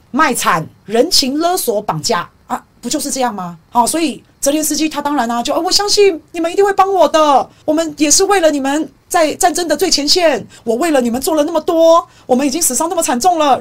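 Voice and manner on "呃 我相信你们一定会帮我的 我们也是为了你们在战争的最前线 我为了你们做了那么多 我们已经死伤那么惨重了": high, loud, whiny guilt-trip plea; Zelensky pleading. Retold or imitated. Commentator mocking Zelensky guilt-tripping allies, whiny high plea. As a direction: lay on the moral pressure like a beggar. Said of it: "人情压力、道德绑架啊".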